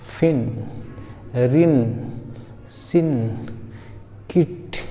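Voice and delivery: brief vowel in फिन, रिन, सिन, किट; short i vowel. Shown as a demonstration. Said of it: "छोटी ई की तरह होगा".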